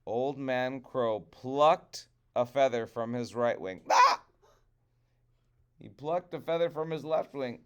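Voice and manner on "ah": high, loud yelp of pain; sharp yelp. Acted. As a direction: yelp as feather is plucked. Feather plucked.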